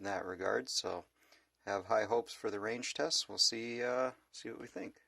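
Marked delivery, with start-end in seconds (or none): none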